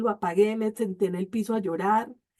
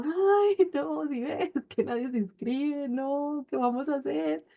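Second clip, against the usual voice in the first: a hint of anxious pleading; worried whine; 4 semitones higher, slower than usual. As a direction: retell the worried moan lightly. Worried complaint to her husband.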